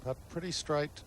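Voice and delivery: ordinary talk, nothing marked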